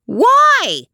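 very high, loud screech; screechy old woman. A narrator's reading. Old woman challenging the man, loud and screechy. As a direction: screech the question out. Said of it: "It was high and screechy".

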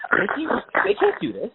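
high, frantic rising alarm; panicking. Retold or imitated. Retelling his quarantine tennis panic to a friend, frantic high voice. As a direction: spiral into a shrill panic. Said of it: "panic attack moment where I'm like".

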